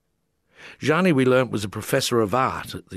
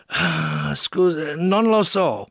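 higher, louder apologetic Italian shrug; shrugging Italian; 7 semitones higher, 4 dB louder, much slower than usual. A narrator's reading. Confused Italian artist apologising, shrugging and higher. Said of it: "Gianni, laughing aloud, shrugged".

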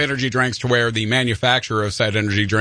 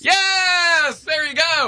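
whooping over the right answer; excited cheer; 14 semitones higher, much slower than usual. Real, not acted. Celebrating correct answer.

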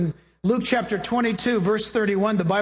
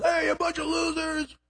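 a mild mock yell, higher; Goliath taunting; 7 semitones higher than usual. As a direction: taunt the army like a bully giant. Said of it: "Goliath got up and yelled".

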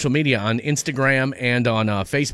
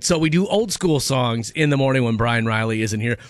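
ordinary delivery

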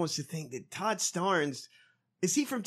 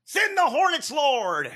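pitched-up hammed-up preacher cry; mock preacher; 9 semitones higher, 4 dB louder, slower than usual. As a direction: parrot the preacher's grand call for hornets, mocking. Mocking the played preacher clip.

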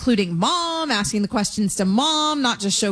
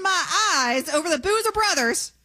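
real teary voice; 5 semitones higher than usual. Real, not acted. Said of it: "I'm crying".

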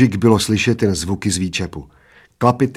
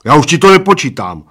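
loud, higher stubborn refusal; raised, dug-in voice; 4 semitones higher, 8 dB louder than usual. A narrator's reading. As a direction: announce it obstinately. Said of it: "oznámil plešatec umíněně".

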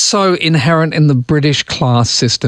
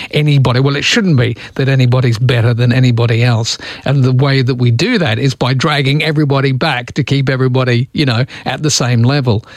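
usual speaking voice

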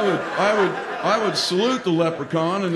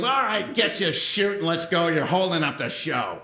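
leprechaun instructor. Retold or imitated. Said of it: "He goes".

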